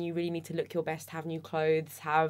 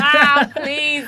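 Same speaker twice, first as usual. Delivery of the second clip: loud, high exasperated wail; exasperated plea; 9 semitones higher, 16 dB louder than usual. Retold or imitated. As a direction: whine it out loud. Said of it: "And you're like".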